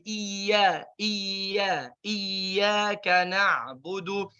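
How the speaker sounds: iya and iyyaka with thin light vowels; light Quranic recitation. Shown as a demonstration. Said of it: "harful hamzah tipis".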